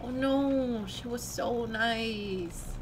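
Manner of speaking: slow, drawn-out whine; hammed-up maudlin grief; drunken lament. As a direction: stretch it out mournfully like a drunk. Drunk friend laments a dead mother.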